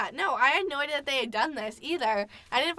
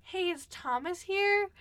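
higher, slow and tentative ask; awkward polite question; 8 semitones higher, 3 dB quieter, slower than usual. Retold or imitated. Asking Ethan's friends for Thomas, awkward and polite. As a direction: ask carefully, feeling awkward. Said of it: "and I was like".

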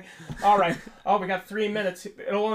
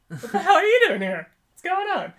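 shocked; 13 semitones higher, 8 dB louder than usual. Retold or imitated. Shocked reaction, much higher.

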